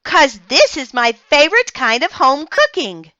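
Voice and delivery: put-on high, bright-eyed kid voice; eager little girl at dinner; eager child. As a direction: gush about the food like a little girl. Child narrator quoting herself.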